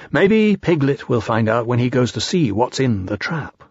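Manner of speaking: plain reading voice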